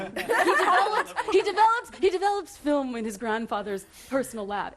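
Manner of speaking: loud, laughing bio parody; mock third-person bio. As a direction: ham up a self-important bio, cracking up. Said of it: "third person narrative of your bio".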